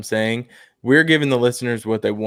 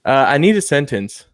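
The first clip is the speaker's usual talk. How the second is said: ordinary talk, nothing marked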